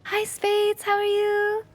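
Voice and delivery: bright high greeting; high sing-song greeting. Real, not acted. Greeting chat viewers, sing-song.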